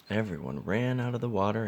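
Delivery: plain reading voice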